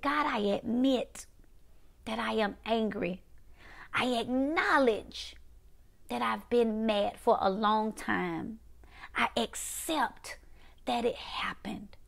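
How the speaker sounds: slow, soft prayer of admission; led confession prayer. Acted. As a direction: lead them through it slowly, pausing between phrases.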